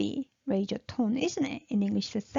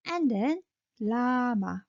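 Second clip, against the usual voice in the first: ordinary delivery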